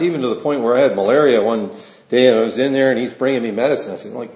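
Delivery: ordinary talk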